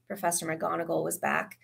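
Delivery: plain reading voice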